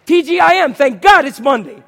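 shouted happy Monday greeting; cheery greeting. Shown as a demonstration. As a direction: greet the neighbour with gusto. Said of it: "that's your homework".

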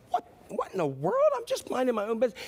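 a touch of offended disbelief; mildly acted offence. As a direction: lightly play the affronted customer. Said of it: "The man is just obviously super offended. He's like".

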